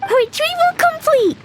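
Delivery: high, proud announcement; triumphant. Acted. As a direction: declare victory. Child triumphantly announces success.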